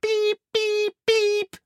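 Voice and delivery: high, even electronic-style beeps; smoke alarm. Acted. The smoke alarm keeps going, high sustained beeps. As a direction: imitate an alarm's piercing pips. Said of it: "sier røykvarsleren".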